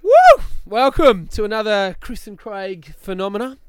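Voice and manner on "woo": cheer. Real, not acted. Show intro cheer.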